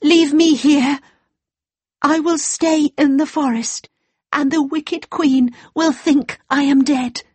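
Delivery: put-on high young girl voice; a frightened girl pleading. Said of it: "she said".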